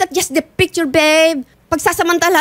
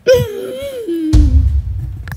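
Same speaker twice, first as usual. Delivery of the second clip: choked sob; 5 semitones higher than usual. Real, not acted. Wife breaking down on air.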